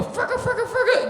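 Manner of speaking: high, laughing music imitation; mock song scratch. Imitating the party track playing, high and laughing. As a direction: mimic the club track.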